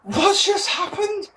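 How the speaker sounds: stunned fan asking what happened; baffled. Retold or imitated. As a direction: sound totally lost at the result. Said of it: "you're just going".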